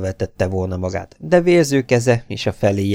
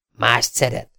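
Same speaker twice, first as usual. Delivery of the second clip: impudent, higher-pitched question; cheeky; 4 semitones higher than usual. A narrator's reading. Rejected suitor prods Mabel about a rival, impudent. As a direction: needle with mild insolence. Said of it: "kérdezte pimaszul".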